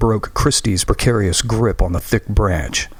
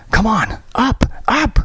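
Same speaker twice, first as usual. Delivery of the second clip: very high, rising urgent call; urgent shout; 13 semitones higher than usual. Acted. Christy shouting down to Graham from the branch, urgent. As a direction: haul him up with your voice.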